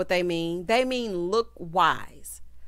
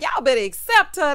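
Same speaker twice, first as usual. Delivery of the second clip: loud, raised-pitch retold demand; emphatic warning; 6 semitones higher, 9 dB louder than usual. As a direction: lay down the law, loud and high. Her own emphatic retort, retold.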